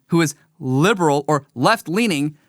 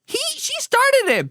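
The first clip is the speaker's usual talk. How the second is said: put-on much higher, slow tattling child voice; whiny little kid; 14 semitones higher, slower than usual. Child tattling to the teacher, high whiny kid voice. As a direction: whine it up to the teacher like a toddler. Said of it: "mediating a fight between two young kids".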